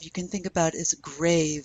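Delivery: ordinary talk, nothing marked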